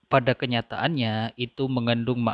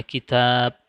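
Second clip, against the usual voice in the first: "kitab" with the second syllable stressed; stress on long vowel; 4 dB louder than usual. Shown as a demonstration. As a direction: press on the long "tab" in "kitab". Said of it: "harus ditekan, dinebrahkan". (tekanan pada suku "tab" dalam "kitab")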